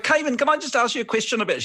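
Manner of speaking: put-on high, quick New Zealand accent; Jackie Van Beek. Director asking actor a question, mock New Zealand accent. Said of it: "she's from New Zealand".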